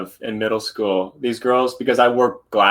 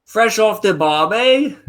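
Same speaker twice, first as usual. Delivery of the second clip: higher, slow put-on Australian accent; mock Australian accent; 11 semitones higher, 4 dB louder, slower than usual. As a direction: ham up an Aussie twang. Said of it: "Can you do it in a British accent?".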